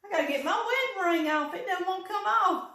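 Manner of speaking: laughing. Real, not acted. Laughing while pulling ring.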